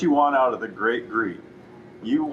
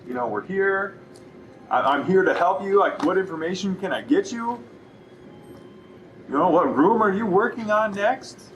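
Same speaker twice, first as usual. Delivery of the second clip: higher, upbeat shop greeting; friendly salesperson greeting; 6 semitones higher than usual. Shown as a demonstration. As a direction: welcome them in and pitch the sale warmly. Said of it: "setting the intention for your interaction".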